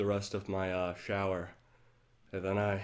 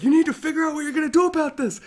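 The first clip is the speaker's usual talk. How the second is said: high, fast, much louder scolding; stern inner voice; 11 dB louder, faster than usual. Retold or imitated. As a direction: drill sergeant snapping at you. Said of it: "He's like".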